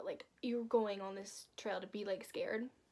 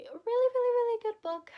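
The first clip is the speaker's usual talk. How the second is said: high, loud, building emphatic repetition; excitedly raised voice, much higher and louder; excited gushing; 13 semitones higher, 12 dB louder than usual. Real, not acted. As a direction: gush louder and higher on every really. Gushing about favorite book.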